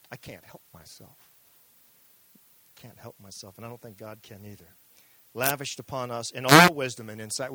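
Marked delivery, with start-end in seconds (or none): 5.3-7.5 louder proclamation of the verse; preaching loud. Real, not acted. Preacher reading scripture to congregation, loud. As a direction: proclaim it with full force.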